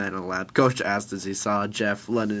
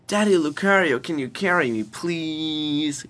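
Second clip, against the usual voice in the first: put-on high, louder little-kid plea; pleading child; 7 semitones higher, 7 dB louder, slower than usual. Kid Chris asks daddy to carry him, pleading. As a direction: beg daddy like a small child.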